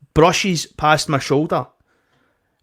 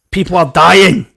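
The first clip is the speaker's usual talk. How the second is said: louder, angry: people are dying; angry outburst; 3 semitones higher, 8 dB louder than usual. Retold or imitated. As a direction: snap it out as a rebuke. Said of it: "you've got to really hammer it home".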